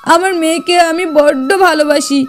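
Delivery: acted startled, stammering plea; tearful pleading. Parent begs not to lose daughter.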